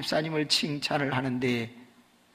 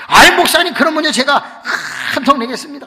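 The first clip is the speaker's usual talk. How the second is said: loud, high happy offer; delighted deacon; 11 semitones higher, 15 dB louder than usual. Retold or imitated. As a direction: treat everyone, beaming. (크게 높여 신나게)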